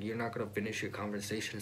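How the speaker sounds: ordinary talk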